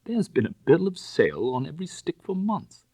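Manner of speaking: high, loud, bitter laughing line; miserable laugh. Acted. Bunny laughing bitterly about his pawned furniture. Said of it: "I laughed aloud in my misery".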